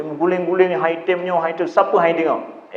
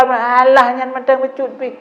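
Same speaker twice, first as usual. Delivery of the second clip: higher, scoffing brush-off; mock the child's anger as trivial; dismissive scoff; 8 semitones higher than usual. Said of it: "Tambah dia marah". (ibu mencemooh, nada meremehkan)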